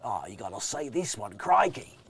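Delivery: drawn-out mock Australian vowels; Australian accent. Shown as a demonstration. Comedian invites listeners to try his Australian accent. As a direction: play up a comic Australian accent. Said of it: "you can get into the Australian".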